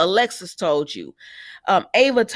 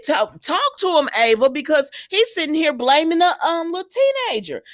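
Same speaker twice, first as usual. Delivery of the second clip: urging Ava; 8 semitones higher than usual. Real, not acted. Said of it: "And I said".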